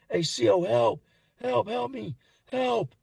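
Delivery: high, loud mock calls for help; imitated cry for help. Young inmate calling the C O, imitated cry for help. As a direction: act out calling desperately for the guard. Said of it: "He starts screaming for help".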